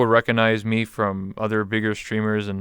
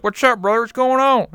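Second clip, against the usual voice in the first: much higher, louder fake American hillbilly accent; 13 semitones higher, 4 dB louder than usual. Canadian streamer reviving his old VRChat hillbilly persona, high loud hillbilly accent. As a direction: ham up a rowdy American hillbilly. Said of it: "act like a hillbilly".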